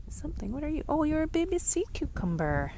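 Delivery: high, soft voice of wonder; cooing at a tiny creature. Real, not acted. Finds tiny creature, coos at it.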